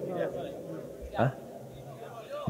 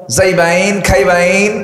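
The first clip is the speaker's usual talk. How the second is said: Mymensingh drawl; 6 semitones higher, slower than usual. Shown as a demonstration. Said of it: "এরকম করে টান মারে".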